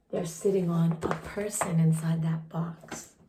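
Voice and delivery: hushed secret aside; soft conspiratorial whisper. Real, not acted. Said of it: "aside whispered".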